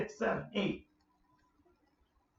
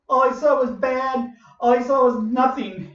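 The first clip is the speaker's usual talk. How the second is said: ordinary talk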